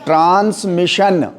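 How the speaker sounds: "ट्रांसमिशन" slowly, with a full, distinct "शन" ending; slow "ट्रांसमिशन", drawing out the final "शन"; clear pronunciation. Shown as a demonstration. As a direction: model "ट्रांसमिशन" syllable by syllable for students. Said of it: "शन बोलेंगे नहीं जब तक".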